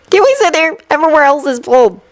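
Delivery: put-on high small child's voice; politely pleading child; seven-year-old voice. A narrator's reading. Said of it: "hardly older than seven".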